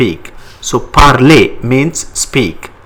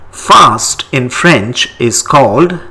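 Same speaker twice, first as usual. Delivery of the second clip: ordinary talk, nothing marked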